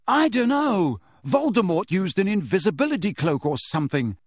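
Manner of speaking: shouted. Acted. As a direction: shout it out, exasperated. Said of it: "Harry shouted".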